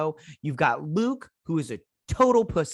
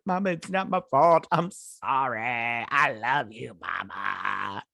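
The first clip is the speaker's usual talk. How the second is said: drawn-out, hammed-up tearful apology; milk the crocodile tears; mock crying; 3 semitones lower, slower than usual. Son apologising to his mama, mock crying. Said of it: "bad acting here with his crying".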